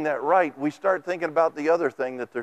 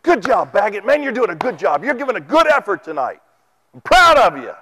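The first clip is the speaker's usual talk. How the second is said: boss praising; 3 semitones higher, 5 dB louder than usual. Retold or imitated. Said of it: "he would see that I was doing a good".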